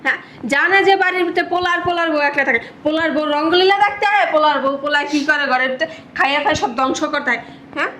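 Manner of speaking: high-pitched scolding, easing slightly towards the end; loud angry tirade. Acted. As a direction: rant so that the mother-in-law hears it. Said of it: "আস্তে, আজকে তোমার".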